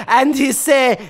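normal talking voice

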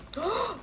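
quick gasp of realization; real startled intake of breath; surprised gasp. Real, not acted. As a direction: gasp in sharply. Realizes what the package holds.

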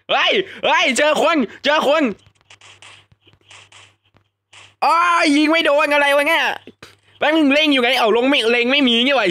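high, loud excited yelling at an enemy. Real, not acted. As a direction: shout it out as the enemy shows up. Spots and shoots an enemy. (ตะโกนตื่นเต้นเสียงสูง)

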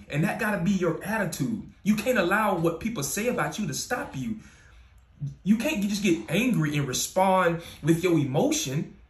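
ordinary talk, nothing marked

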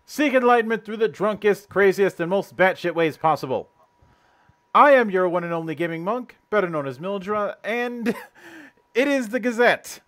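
booming host welcome to the Monastery; show host intro. Acted. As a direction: open the show like a hype announcer. Host's grand show opening.

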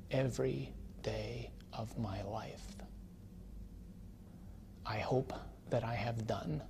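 soft, breaking whisper at a grave; hushed old veteran. Retold or imitated. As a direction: speak softly to the dead. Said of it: "And he says".

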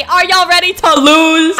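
loud, drawn-out taunt. Acted. Player taunting the rival team as she joins, loud taunt. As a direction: throw down a slow, loud challenge.

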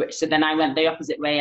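usual speaking voice

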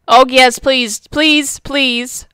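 ordinary talk

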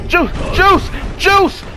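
frantic yelling. Real, not acted. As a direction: escalate into exasperated, drawn-out yelling. Panic over failing juice command.

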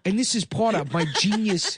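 mock foreign-accented politician; Andrew Yang. Presidential candidate announcing a giveaway, mock foreign accent. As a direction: announce policy in a put-on broken-English accent. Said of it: "using that classic Yang".

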